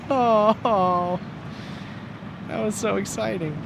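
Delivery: losing voice, hoarse and worn. Real, not acted. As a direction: speak with a voice going hoarse. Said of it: "Oh, I'm losing my voice".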